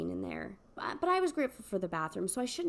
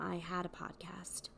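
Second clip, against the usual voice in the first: whisper; 4 semitones lower, 11 dB quieter than usual. Acted. Aside about ex finding podcast.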